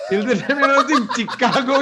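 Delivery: high and laughing; laughing tease. Acted. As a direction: tease them through a laugh. Teasing about accent, laughing.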